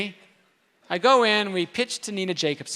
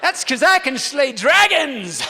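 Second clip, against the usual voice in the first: mock boast; 6 semitones higher, 10 dB louder than usual. Jokingly boasting about himself.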